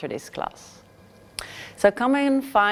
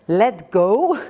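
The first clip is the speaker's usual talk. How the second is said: louder, disbelieving question; incredulous; 5 dB louder than usual. Acted. Pilgrim hears God say let go, louder shocked disbelief. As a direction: throw the words back in disbelief.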